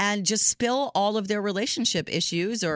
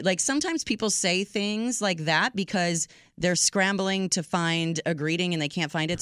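usual speaking voice

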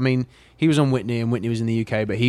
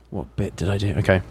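normal talking voice

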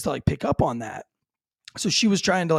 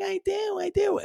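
high, squeaky, defensive "I do, I do"; high-pitched mock wife insisting; mock wife protest. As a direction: play the wife insisting she does spank, much higher. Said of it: "She's like".

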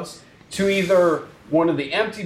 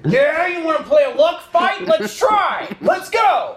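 fired up; 10 semitones higher, slower than usual. Real, not acted. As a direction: egg them on to fight. Player challenges GM loudly.

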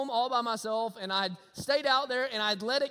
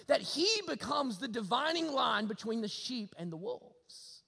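usual speaking voice

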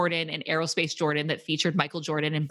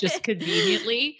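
slow, higher, laughing aside; mocking the plot, laughing; sarcastic laugh; 9 semitones higher than usual. Real, not acted. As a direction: drag it out with a laugh.

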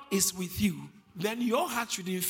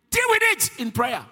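louder, higher burst; sudden shout; 9 semitones higher, 11 dB louder than usual. Real, not acted. Preacher to congregation, sudden loud burst. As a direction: bark it out.

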